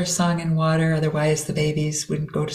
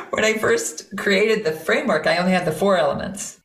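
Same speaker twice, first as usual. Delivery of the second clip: usual speaking voice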